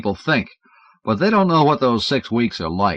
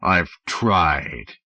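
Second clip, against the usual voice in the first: low, sulky alien voice; thick sulky voice; 4 semitones lower than usual. Acted. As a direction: sulk it in a low voice. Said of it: "thick, sulky voice".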